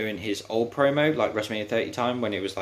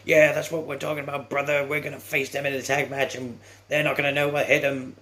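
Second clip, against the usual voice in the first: hyped-up wrestler promo; 6 semitones higher than usual. Retold or imitated. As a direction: hype it up like a cheesy eighties wrestler. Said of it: "It's like".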